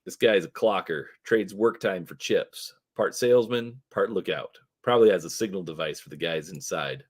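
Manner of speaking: usual speaking voice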